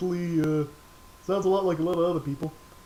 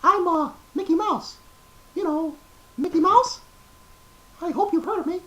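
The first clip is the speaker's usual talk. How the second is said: much higher, squeaky cartoon mouse voice; Mickey Mouse; 13 semitones higher, 4 dB louder than usual. Opening the voice show for viewers, high cartoon mouse. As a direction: chirp it out like the famous mouse. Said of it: "a little bit of Mickey Mouse".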